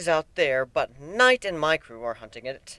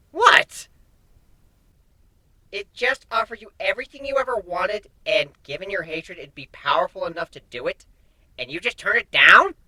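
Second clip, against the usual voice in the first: disbelieving; 4 semitones higher than usual. A narrator's reading. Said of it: "Nightmare asks".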